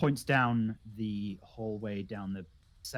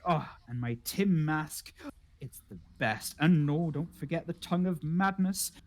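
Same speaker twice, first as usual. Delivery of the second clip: proud, slightly raised voice, easing into wistfulness; 3 semitones higher than usual. Acted. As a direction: show off your garden, savouring each name. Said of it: "very proudly goes".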